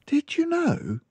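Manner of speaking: lightly voiced librarian frowning; concerned librarian. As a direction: librarian mildly worried for the child. Librarian teases news.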